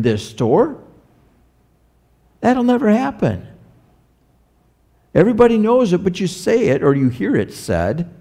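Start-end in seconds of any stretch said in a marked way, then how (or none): none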